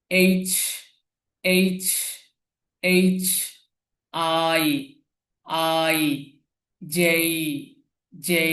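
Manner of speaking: letters from এফ to ভি each held out alone, with clear pauses; model letter pronunciation. Shown as a demonstration. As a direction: recite এফ, জি, এইচ as a teacher modelling drawn-out letter names. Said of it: "আপনারা উচ্চারণটা follow করবেন".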